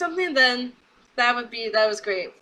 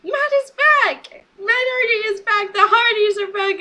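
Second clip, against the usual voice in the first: much higher, louder fan cheer; excited shout; 8 semitones higher, 3 dB louder than usual. Retold or imitated. Fan telling how she reacted, high excited cheering. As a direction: shout the news like you can't believe it. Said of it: "So I said".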